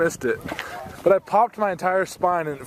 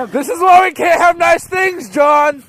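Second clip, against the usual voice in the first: loud, higher scolding yell; shouting over wind; 10 dB louder, slower than usual. Real, not acted. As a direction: yell it across the field. Yelling across the field.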